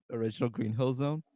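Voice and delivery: ordinary talk, nothing marked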